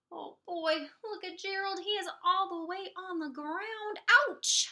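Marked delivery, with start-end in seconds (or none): none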